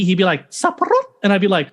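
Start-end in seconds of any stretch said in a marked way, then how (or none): 0.5-1.1 Saparot squeaked very high in Mickey's falsetto; Mickey Mouse voice. Retold or imitated. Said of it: "when he would do it as Mickey".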